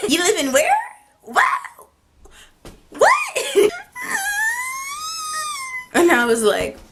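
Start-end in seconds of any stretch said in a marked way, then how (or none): 0.1-3.1 high, loud stunned what; can't believe what she heard; shocked disbelief. Real, not acted. Shocked at Manchester reveal. 3.2-5.9 high screaming laugh; scream-laugh in shock; shrieking laugh. Real, not acted. Shriek after Manchester reveal.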